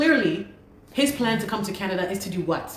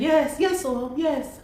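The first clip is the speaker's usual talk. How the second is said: mimicked confirmation; 8 semitones higher, slower than usual. Imitating the immigration lady's reply.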